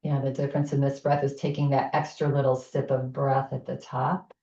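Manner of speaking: pace the listener through each inhale and exhale; guided breathing. Acted. Leads a breathing exercise.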